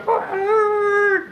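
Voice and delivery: high drawn-out engine roar; a racecar roar done with the voice; imitated engine roar. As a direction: mimic a roaring race car.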